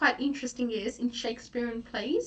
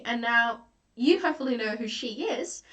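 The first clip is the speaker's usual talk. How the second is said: usual speaking voice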